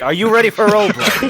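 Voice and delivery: loud, rising hype question; hype host call. Real, not acted. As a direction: pump up the crowd like an MC. Host hyping a player.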